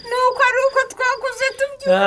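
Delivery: said through a high laugh; sheepish laughing admission. Acted. Mother laughs at herself.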